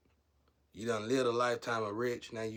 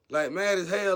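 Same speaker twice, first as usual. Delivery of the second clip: louder repeat, punching it; loud emphatic; 9 semitones higher, 11 dB louder than usual. Shown as a demonstration. Telling viewers how furious they were, louder. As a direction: belt it out the second time.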